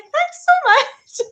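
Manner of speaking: high, loud, drawn-out thank-you; laughing, over-the-top gratitude; gushing thanks. Retold or imitated. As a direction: squeal your thanks, stretched out. Acts out delighted thanks for free lesson.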